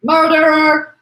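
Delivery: high, loud accusing yell; sudden shout. Acted. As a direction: burst out with the accusation. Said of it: "he shouted suddenly".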